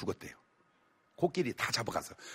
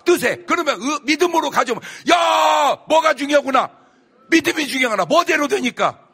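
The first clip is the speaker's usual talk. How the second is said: much higher, loud shouting sermon; fiery preaching; 10 semitones higher, 10 dB louder than usual. Acted. Preacher shouts the lesson to the congregation, fiery. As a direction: drive the point home at full force.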